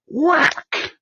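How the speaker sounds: a sharp high mock whack sound; imitated arrow whack. Retelling the arrow striking the deer, a high mock whack. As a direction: snap out the thwack of an arrow hitting. Arrow hit heard in woods.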